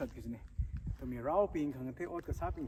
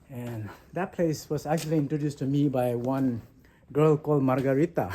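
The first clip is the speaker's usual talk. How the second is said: ordinary talk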